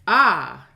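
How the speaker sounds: short, loud "ah"; startled yelp. Real, not acted. Player wary of jump scares, startled yelp. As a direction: yelp suddenly, loud and brief.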